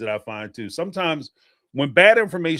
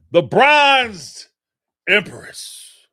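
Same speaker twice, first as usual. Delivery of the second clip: high, loud ring-announcer call with long held notes; hype announcer; 10 semitones higher, 6 dB louder, much slower than usual. Real, not acted. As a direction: drag out the last words like a prize-fight announcer. Showy announcement of paid chat.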